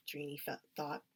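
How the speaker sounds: ordinary delivery, nothing marked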